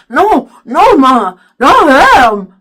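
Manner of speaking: loud, high garbled protest; mouth-full mumbled yell. A narrator's reading. Byron panics at being pulled off, garbled yelling. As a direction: protest wildly through stuck lips. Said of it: "again mumbling".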